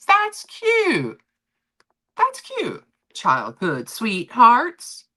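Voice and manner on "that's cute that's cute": high, cooing voice. Real, not acted. Streamer cooing at viewers' sweetheart story, high coo.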